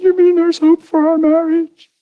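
high, imitated tearful question; bawling wife. Wife offered love by her husband, high mimicked tearful hope. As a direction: ask in a wife's squeaky weepy voice. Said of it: "My wife's bawling".

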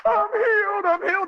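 high, wailing imitation of happy crying; acted tearful cry of joy. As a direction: mimic an overwhelmed woman crying out. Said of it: "she's crying and saying".

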